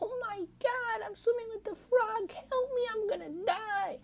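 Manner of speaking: mock panic. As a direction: squeal in fake terror, crying for help. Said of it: "they're like".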